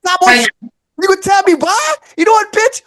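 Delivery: high, slow, hammed-up cussing out; mock angry outburst. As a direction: tell her off in a mock rage, cracking up at the end. Acted outburst at the girl.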